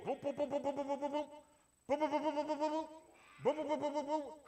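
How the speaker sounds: rhythmic boop boop of doors shutting; door-closing sounds. Retold or imitated. Preacher mimicking God closing doors, rhythmic boops. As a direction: pop out each boop like a door slamming.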